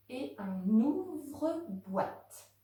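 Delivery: ouvre-boîte stretched slowly, beat by beat; syllables spaced out. Shown as a demonstration. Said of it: "À vous maintenant pour trier". (ouvre-boîte lentement, syllabe par syllabe)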